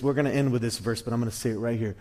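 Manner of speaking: normal talking voice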